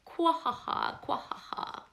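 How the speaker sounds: loud, high croaky frog cackle; croaking frog laugh. Acted. The fat frog laughing at the animals, loud and croaky. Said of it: "said the frog".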